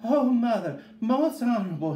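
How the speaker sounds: put-on high, loud, urgent child's cry; high-pitched panting little boy; panted plea. A narrator's reading. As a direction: call out to mother as if winded from running. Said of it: "he panted".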